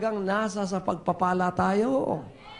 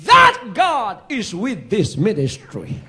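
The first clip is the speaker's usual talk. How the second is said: emphatic proclamation; 8 dB louder, slower than usual. Real, not acted. Preacher proclaims loudly.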